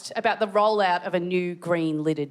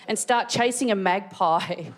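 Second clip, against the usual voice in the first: usual speaking voice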